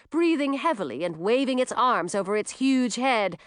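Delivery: ordinary delivery, nothing marked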